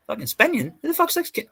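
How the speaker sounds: high-pitched incredulous questions; puzzled, pitch jumping right up; retold bafflement. Telling how he first heard of Spanion, incredulous. Retelling his baffled reaction.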